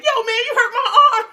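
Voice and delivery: high, loud playful protest; mock complaint. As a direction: re-enact a yelp of protest after play fighting. Acts complaint during play fighting.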